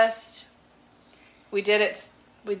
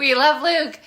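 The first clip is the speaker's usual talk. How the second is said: eager reassurance; 6 semitones higher, 6 dB louder than usual. Real, not acted. Making up for teasing Luke.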